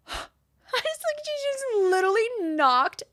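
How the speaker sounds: very high, strained, drawn-out squeal; breath knocked out. Retold or imitated. Client winded by the coach's price, shocked. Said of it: "the fucking breath out of me".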